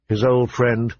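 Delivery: ordinary delivery, nothing marked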